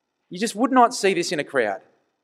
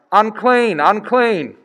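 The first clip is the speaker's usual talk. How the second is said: loud, high cry of unclean; yelled warning; 6 semitones higher, 7 dB louder, slower than usual. Retold or imitated. Leper crying out to the crowd, loud and high. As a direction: call it out loud to warn people off. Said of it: "and yell out".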